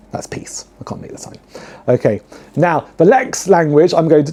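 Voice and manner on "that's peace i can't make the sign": breathy whisper, no voice at all; whispered aside. Real, not acted. Vlogger whispers a quick aside to viewers, hushed. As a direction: whisper it as a private aside to the camera.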